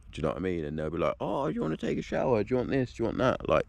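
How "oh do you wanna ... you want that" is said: high, soft, overly welcoming offers; mock eager host. Neighbour offering a stranger a shower, high soft mock-sweet voice. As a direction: play the fussy hospitable neighbour.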